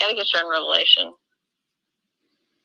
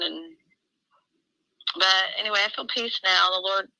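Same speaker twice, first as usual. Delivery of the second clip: ordinary delivery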